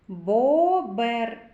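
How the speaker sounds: "Бобер" with a long, loud О; vowel drawn out. Shown as a demonstration. Teacher singles out a sound for children, "Бобер" with О drawn out. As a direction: stretch and punch the О in "Бобер". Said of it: "О. Голосний".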